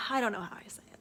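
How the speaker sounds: whispered "I don't know how I say it"; confessing her confusion in a whisper. Real, not acted. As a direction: breathe it out in a flustered whisper. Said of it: "unvoiced whisper".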